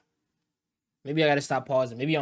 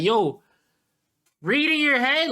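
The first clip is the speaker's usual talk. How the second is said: high, raised-voice imitation of a scolding; mock teacher yell; 11 semitones higher, 5 dB louder, slower than usual. Streamer imitates a teacher telling a kid off, mock yelling. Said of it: "the teacher was yelling at some kids".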